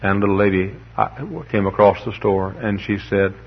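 ordinary talk, nothing marked

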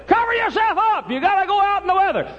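very high, loud mama voice; hollering mother. Retold or imitated. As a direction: holler orders at your son like a fussing mother. Mama would say.

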